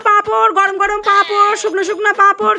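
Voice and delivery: loud, chanting vendor's call; loud rhythmic sales patter; street hawker's cry. Acted. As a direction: hawk hot papad down the street. Street vendor selling papad.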